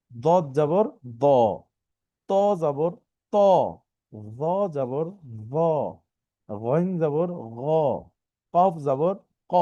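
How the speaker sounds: each "জবর" syllable drawn out, ending in rounded o; slow letter drill. Shown as a demonstration. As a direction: slowly sound out "গইন জবর গ" and the rest, pausing between each. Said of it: "ওর মতো করে উচ্চারণটা হবে".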